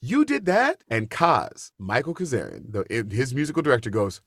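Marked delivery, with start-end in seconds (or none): 0.0-0.8 very high surprised question; disbelief. Retold or imitated. Alan hearing the audition story, high surprise. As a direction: gasp it out in disbelief. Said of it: "He goes".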